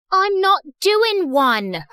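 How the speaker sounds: put-on slow, high British accent. Student denying it to the principal, drawn-out British accent. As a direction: protest slowly in a heavy British accent. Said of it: "stop with that British accent".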